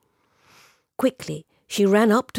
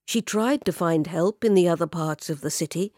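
usual speaking voice

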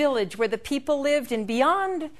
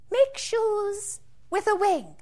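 high bird voice; 8 semitones higher, 4 dB quieter, slower than usual. Acted. Bird character speaks.

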